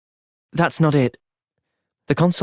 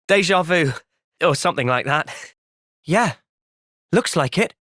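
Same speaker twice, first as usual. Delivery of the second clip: normal talking voice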